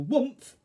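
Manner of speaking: high, loud rocket whoomph. A narrator's reading. Narrator launches the rocket, loud imitated whoomph. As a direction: burst out a sudden take-off noise.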